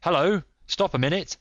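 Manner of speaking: high, sudden halt; surprised exclamation. A narrator's reading. Holmes spots someone in the bush, startled. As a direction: call out in surprise.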